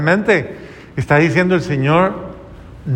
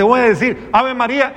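mock sarcastic tone, pitched higher; 7 semitones higher than usual. A parishioner mocking the priest's advice, mock sarcastic. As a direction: imitate someone answering with sarcasm. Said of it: "me dice así como sarcásticamente".

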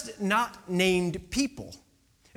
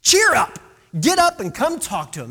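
high, loud brisk urging; loud cheering-on; 6 semitones higher, 8 dB louder than usual. Acted. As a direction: rouse him with a hearty shout. Acted crowd hurrying blind man up.

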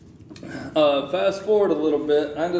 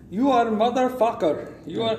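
higher, put-on Indian accent; mock Indian insult; 5 semitones higher than usual. Scammer jokingly cursing the caller, mock Indian accent. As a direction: tease him with an Indian accent.

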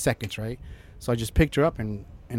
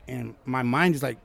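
ordinary talk, nothing marked

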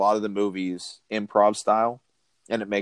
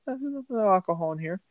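very high mock denial; squeaked tipsy denial; acted-out drunk; 9 semitones higher, faster than usual. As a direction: imitate a drunk protesting innocence, pitched way up. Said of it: "He's obviously hammered".

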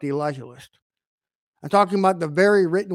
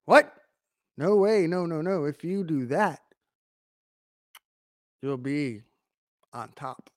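sly tempter. Retold or imitated. Satan voiced tempting Eve.

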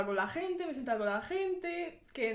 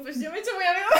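laughing quote; 6 semitones higher, 7 dB louder, much faster than usual. Retold or imitated.

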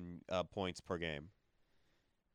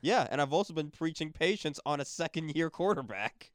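raised voice, arguing; heated loud; 10 semitones higher, 7 dB louder than usual. Real, not acted. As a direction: push back loud and high. Heated fan argument.

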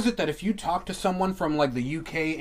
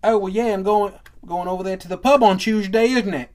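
hammed-up British accent, higher and louder; mock British accent; 5 semitones higher, 6 dB louder than usual. Host parodying British pub-goers, mock British accent. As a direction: imitate a Brit with "Chewsday, innit". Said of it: "Like, they could be like".

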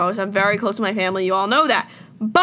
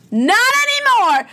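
much higher, louder exasperated cry; outraged outburst; 11 semitones higher, 4 dB louder than usual. Real, not acted. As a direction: burst out in frustration. Ranting about cancelled plans.

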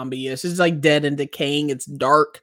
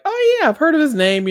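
higher offhand oh yeah; casual mock interest; 13 semitones higher, 5 dB louder than usual. Quoting himself to the parents about Warhol, higher and offhand. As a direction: play it mildly interested. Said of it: "I was kinda like".

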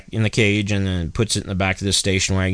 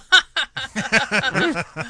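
laughing. Real, not acted. Teller laughs after punchline.

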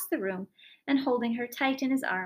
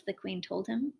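plain reading voice

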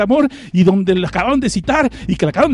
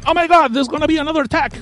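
high, hammed-up American alarm; high mock American panic; mock gringo panic; 8 semitones higher than usual. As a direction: play a panicky gringo soldier. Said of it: "se ha traducido".

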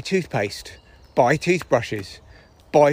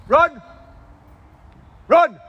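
calling the dog; 12 semitones higher, 4 dB louder, slower than usual. Real, not acted.

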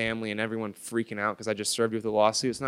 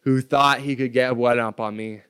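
scornful anger; 3 semitones higher, 7 dB louder than usual. Retold or imitated. Retelling his angry reaction.

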